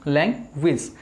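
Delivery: teacher models लैंग्वेज for students to repeat; correct pronunciation. Shown as a demonstration. English teacher modeling the word लैंग्वेज, slow and clear. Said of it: "अब मेरे साथ बोलना है".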